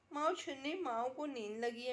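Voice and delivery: normal talking voice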